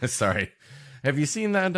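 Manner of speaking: usual speaking voice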